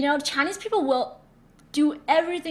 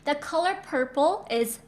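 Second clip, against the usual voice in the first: ordinary delivery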